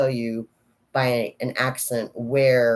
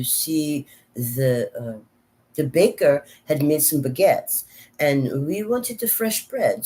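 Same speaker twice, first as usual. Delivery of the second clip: French accent; 4 semitones higher than usual. Shown as a demonstration. Telling of burnt baguettes at the baker's, French accent. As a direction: pretend French accent, higher than usual voice. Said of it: "let's pretend I'm from France".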